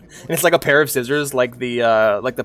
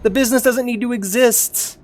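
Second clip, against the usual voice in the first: heated retort; 10 semitones higher, 4 dB louder than usual. Retold or imitated. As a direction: push back hard on the business guys. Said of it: "And I'm like".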